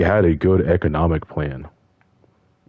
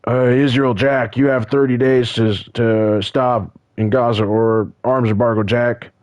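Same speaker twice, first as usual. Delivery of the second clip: imitating Biden; 6 semitones higher than usual. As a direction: ham up a blustering Biden warning. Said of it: "was going".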